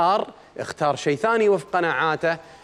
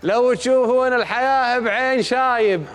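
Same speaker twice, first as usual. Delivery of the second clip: slow, raised chanted verse; poem recitation; 7 semitones higher, slower than usual. Acted. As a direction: intone the poem line slowly. (إلقاء شعري بطيء)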